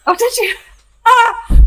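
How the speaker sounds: bursting into high laughter; laughing. Real, not acted. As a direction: laugh through the words. Amused at the licorice joke.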